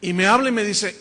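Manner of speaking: normal talking voice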